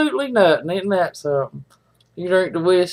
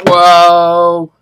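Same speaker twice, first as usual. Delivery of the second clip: loud whoa; 3 semitones lower, 8 dB louder than usual. Real, not acted. After drinking water.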